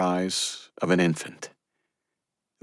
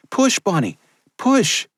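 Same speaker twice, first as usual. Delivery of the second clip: higher, louder cry to push; urgent yell; 4 dB louder, slower than usual. Acted. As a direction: drive her on with each push. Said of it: "Maria yelled".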